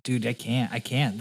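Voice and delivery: usual speaking voice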